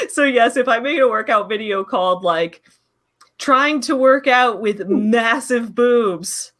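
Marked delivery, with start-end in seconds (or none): 3.4-6.5 very slow, drawn-out title; slow title read. Retold or imitated. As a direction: draw out the title. Slow mock video title.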